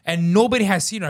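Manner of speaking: high, indignant outburst; worked-up retold complaint; retold screaming. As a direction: protest for the child. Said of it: "as I'm screaming".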